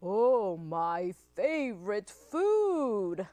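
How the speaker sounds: loud, slow, drawn-out monster voice; hungry Gruffalo. Acted. Gruffalo finds mouse to eat, hungry relish. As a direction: savour each word like a hungry monster. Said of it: "the Gruffalo said".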